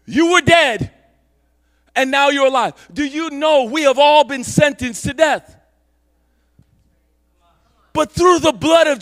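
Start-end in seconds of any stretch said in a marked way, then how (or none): none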